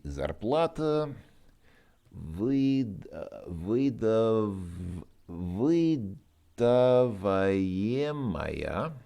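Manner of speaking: halting, word drawn out very slowly; slow sounding out. Real, not acted. Learner building a Russian participle, halting. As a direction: stretch выдаваемая and бухгалтерией slowly, piece by piece.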